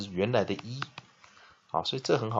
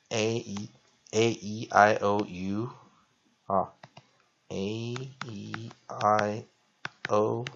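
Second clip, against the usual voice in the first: A E I O U drawn out slowly; slow classroom recitation of vowels; slow drill; much slower than usual. Shown as a demonstration. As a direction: say each vowel slowly for students to copy. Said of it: "teacher drills vowel names".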